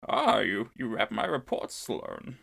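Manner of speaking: menacing villain, trailing off; Sith voice. Acted. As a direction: purr it like a Sith lord. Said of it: "And Vidian is impressed".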